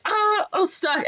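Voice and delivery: high, slow mock distress; hammed-up cry for help; trapped wail. Voicing Benny trapped in collapse.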